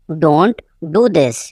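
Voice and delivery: "don't", a clear pause, then "do this"; slow teaching take, split after "don't"; slow repeat. Shown as a demonstration. Teacher repeating for learners, slow and divided.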